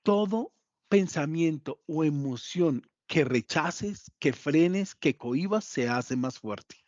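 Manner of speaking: slowed right down, each phrase weighed; deliberate slow teacher stress; slow emphatic warning. Shown as a demonstration. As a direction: spell it out slowly so they remember. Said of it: "quiero que se lo graben".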